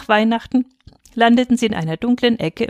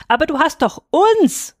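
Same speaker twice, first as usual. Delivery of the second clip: put-on high, louder childlike protest; little girl protesting; 9 semitones higher, 4 dB louder than usual. A narrator's reading. Little Lena tugging father's sleeve, high protest.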